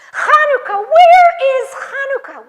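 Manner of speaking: loud call across the tent. Acted. Storyteller loudly calling to the congregation, calling out. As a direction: shout out for the missing Hanukkah.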